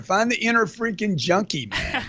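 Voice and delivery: cracking up while riffing on the phrase; laughing. Real, not acted. Riffing on the host's inner junkie idea, laughing.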